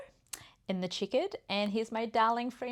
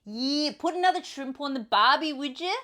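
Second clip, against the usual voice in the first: broad, hammed-up Australian; Australian accent; 3 semitones higher, 4 dB louder than usual. Player in accent roulette, hammed-up Australian accent. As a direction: put on an exaggerated Aussie accent. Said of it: "Okay, we're Australian".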